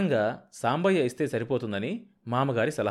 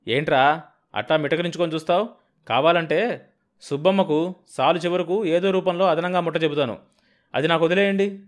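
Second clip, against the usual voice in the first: irritated argument; 6 semitones higher, 6 dB louder than usual. Acted. Husband argues with brother-in-law.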